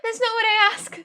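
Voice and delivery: loud, high groan of annoyance; exasperated ugh. Retold or imitated. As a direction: throw up your hands with a shouted ugh. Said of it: "I'm like".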